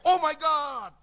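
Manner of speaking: high startled cry of surprise. Retold or imitated. Thomas startled by Jesus appearing, crying out high. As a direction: gasp it out as if shocked. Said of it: "Dia teriak".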